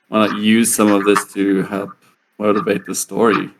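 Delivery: usual speaking voice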